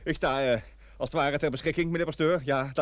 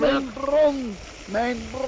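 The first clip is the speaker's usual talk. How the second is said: anguished lament; 5 semitones higher, much slower than usual. Acted. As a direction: wail for the spring, then let it sink. Man mourns his lost spring. (jammerende klacht om de bron)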